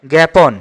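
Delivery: "জ্ঞাপন" firmly as gæpon; open e pronunciation. Shown as a demonstration. Teacher repeats the exception to students, "জ্ঞাপন" as gæpon. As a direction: give "জ্ঞাপন" an æ vowel. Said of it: "এখানে এ উচ্চারণ হচ্ছে".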